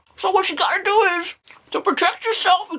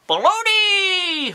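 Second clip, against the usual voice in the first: delighted drawn-out exclamation; much slower than usual. Real, not acted. To himself over lunch, slow delighted cry. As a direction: stretch the word out slowly, relishing it.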